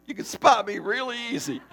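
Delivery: laughing through the words; amused laughing aside. Real, not acted. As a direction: giggle at himself. Laughing about standing out.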